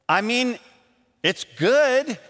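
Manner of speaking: high, strained hedge; flustered. Retold or imitated. Answerer trying to save face with the cook, flustered. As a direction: squeeze out a weak compliment. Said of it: "It's just a losing battle".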